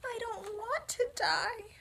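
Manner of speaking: moaning. Acted. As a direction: moan it sadly. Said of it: "he moaned".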